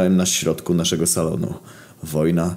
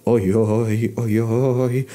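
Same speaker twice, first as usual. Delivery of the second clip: higher, wailing dismay; frightened stammer; 5 semitones higher, much slower than usual. Acted. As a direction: moan fearfully at what appears. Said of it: "wyjąkał w przestrachu Hitler".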